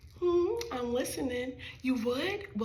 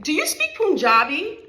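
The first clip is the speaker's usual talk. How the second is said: put-on child's loud blurt; 6 semitones higher, 11 dB louder than usual.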